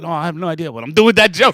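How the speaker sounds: loud laughing confession, fast; laughing admission. Retold or imitated. Comedian admitting to mentor, laughing loudly. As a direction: laugh it out, clueless.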